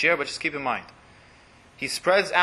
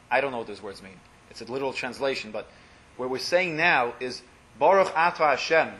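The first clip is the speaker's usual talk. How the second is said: ordinary talk, nothing marked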